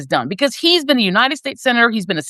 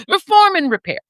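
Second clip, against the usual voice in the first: higher-pitched mocking slogan; mock Democrat; 8 semitones higher than usual. Mocking moderate Democrats' preferred slogan, higher and mock earnest. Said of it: "which sounds so Democrat".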